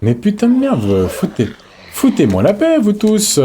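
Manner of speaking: high-pitched fed-up outburst; exasperated, pitch swooping up. Real, not acted. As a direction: whine at them to back off. Fed up with attacking enemies.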